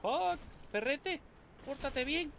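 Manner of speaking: high, slow coaxing for a dog; coaxing pet voice. Real, not acted. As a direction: coax it like a pet. Coaxing enemy dog in game.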